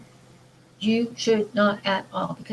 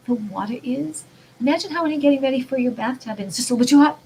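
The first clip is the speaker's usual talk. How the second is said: normal talking voice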